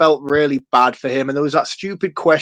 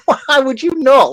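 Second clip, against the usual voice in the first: higher, slow, disbelieving comeback; incredulous retort; 10 semitones higher, 4 dB louder than usual. Retold or imitated. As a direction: stretch it out in sheer disbelief. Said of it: "I'm like".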